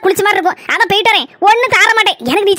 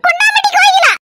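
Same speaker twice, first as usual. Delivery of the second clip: குண்டாமட்டி கோயிலா said much higher, savoring it; gloating daughter-in-law; 11 semitones higher than usual. Acted. Daughter-in-law gloating over nickname, high savoring voice. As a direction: relish the nickname in a high sing-song.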